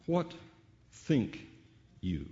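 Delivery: WHAT, THINK, YOU each set apart by pauses; stress on each word. Shown as a demonstration. Preacher teasing out the phrase for the congregation, each word stressed separately. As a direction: weigh out "what", "think", "you" one at a time. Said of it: "the way you emphasize each".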